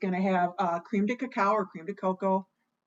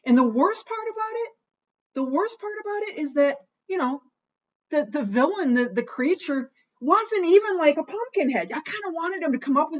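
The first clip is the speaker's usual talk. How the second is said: pitched way up, louder, worked-up complaint; exasperated rant; 8 semitones higher, 4 dB louder than usual. Real, not acted. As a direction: let the frustration climb as you vent. Ranting about disappointing movie villain.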